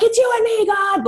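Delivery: high, loud defiant cry to heaven; Lieutenant Dan. Acted. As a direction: holler it up at God in defiance. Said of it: "I call it my Lieutenant Dan moment".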